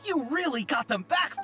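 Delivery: high, thrilled exclamation; overjoyed relief. Acted. Villager thanks those who got his parts back, thrilled. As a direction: marvel gratefully, voice lifted high.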